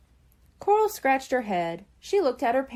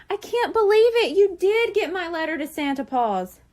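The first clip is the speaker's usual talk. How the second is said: much louder and higher; thrilled; 7 semitones higher, 5 dB louder than usual. A narrator's reading. Shrimp hugs his sister, thrilled and loud. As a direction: burst with joy.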